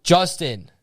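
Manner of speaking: high mock shout of a name; retold yell. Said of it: "they'll yell at their".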